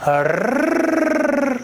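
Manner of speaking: sustained trilled r; long rolled r. Shown as a demonstration. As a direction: hold the r sound, high and drawn out. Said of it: "صدای".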